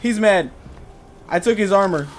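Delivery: loud high repeated yells; panicked shouting. Real, not acted. Enemy furious, player panicking.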